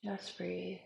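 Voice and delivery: guided meditation. Acted. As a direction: ease them into calm. Guided breathwork, soft voice.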